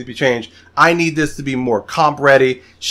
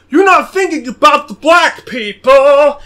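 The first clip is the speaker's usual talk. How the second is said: high, loud, slowed shout mocking an outraged poster; mock outraged critic; 7 semitones higher, 7 dB louder than usual. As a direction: ham up an online critic yelling in fury. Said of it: "it's like".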